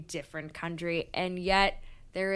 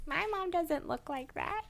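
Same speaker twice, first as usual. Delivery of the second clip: high incredulous voice; 10 semitones higher than usual. Retold or imitated. Quoting herself incredulous.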